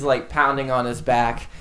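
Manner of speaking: ordinary delivery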